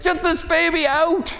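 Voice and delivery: high, strained mock scream; Mary screaming in labour. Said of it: "she actually screams out something like".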